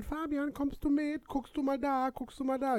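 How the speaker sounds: put-on high child voice. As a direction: ask like an eager little kid. Voices the little godchild. (hohe Kinderstimme)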